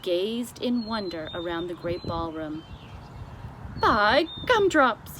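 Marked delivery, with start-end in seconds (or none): 3.8-5.2 loud, high, slow giant exclamation; BFG amazed cry. A narrator's reading. The giant gazes at the ballroom, crying out amazed. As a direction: stretch it out in wonder. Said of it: "he cried".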